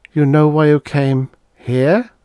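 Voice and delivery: high woman's voice, resigned then probing; Dana resigned. Acted. Dana beginning her story to Harvey, resigned. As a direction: give in and begin to explain.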